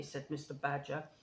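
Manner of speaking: plain reading voice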